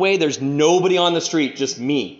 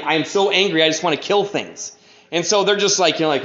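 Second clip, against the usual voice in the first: ordinary delivery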